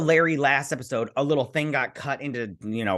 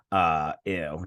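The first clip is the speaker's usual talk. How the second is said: usual speaking voice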